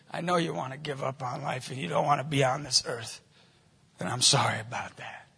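low, soft, sincere voice; gentle compassion. Retold or imitated. Looking a despairing girl in the eyes, softly compassionate. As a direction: say it quietly, straight to one person.

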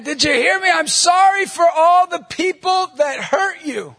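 high, loud, stretched-out holler; insistent shouted repeat. Retold or imitated. Stranger repeating apology to kids across the street, shouting. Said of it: "I said it again".

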